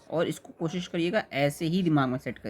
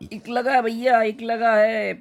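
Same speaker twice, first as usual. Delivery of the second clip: higher and louder, pressing the point; emphatic loud call; 7 semitones higher, 6 dB louder, slower than usual. Acted. Teacher to students, a loud emphatic correction. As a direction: hammer home the correction.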